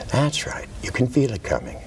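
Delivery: hushed suggestive whisper. Real, not acted. Hypnotist guiding hand movement.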